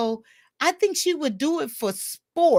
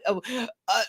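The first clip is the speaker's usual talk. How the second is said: usual speaking voice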